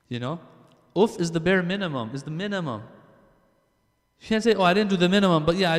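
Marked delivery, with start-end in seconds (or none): 4.2-5.8 higher and louder, hammering the point; emphatic outburst. Real, not acted. Preacher scolding youths who hurt their parents, loud and emphatic. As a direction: call it out from the pulpit.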